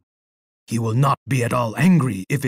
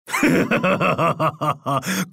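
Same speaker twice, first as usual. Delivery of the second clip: gloating laugh; 3 semitones higher than usual. Acted. Emperor gloats over bloodshed.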